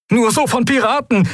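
very high, urgent radio fragment; high-pitched alarmed caller; distress caller. A narrator's reading. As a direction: push out a high warning through static. Last fragment of static-broken distress call.

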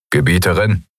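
lightly tinny guard voice; a hint of a hollow armored guard. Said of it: "mit blecherner Stimme".

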